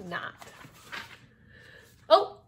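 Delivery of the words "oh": high, loud plea; desperate shout. A narrator's reading. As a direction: beg at the top of the voice. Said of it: "shouted the mouse".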